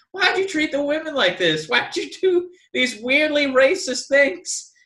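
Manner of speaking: high-pitched, exasperated questioning; indignant protest. Retold or imitated. Viewer protesting how wrestling show treats women, indignant. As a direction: plead with the show in disbelief. Acting out upset viewer reaction.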